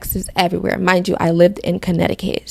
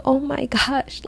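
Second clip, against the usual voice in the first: amazed; 6 semitones higher, 7 dB quieter, slower than usual. Retold or imitated. Amazed reaction retold.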